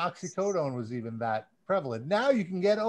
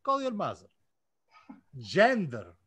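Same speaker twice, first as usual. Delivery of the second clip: put-on Russian accent; 4 semitones higher than usual. Reading fan names aloud to listeners in a light mock Russian accent. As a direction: lightly play a Russian announcer. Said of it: "I'm gonna do it with a Russian accent for you".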